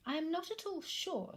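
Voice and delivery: high musing mother; mock thoughtful musing. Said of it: "in a thoughtful voice".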